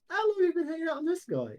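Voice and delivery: high, quick questioning voice; curious character. Acted.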